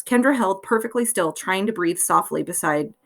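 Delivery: ordinary delivery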